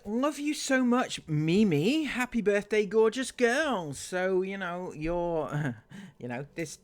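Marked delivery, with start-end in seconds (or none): 0.1-3.9 high, syrupy mock-adoring read; gushing fan. Reading a stranger's comment in a mock-gushing voice. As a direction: coo it like a doting stranger.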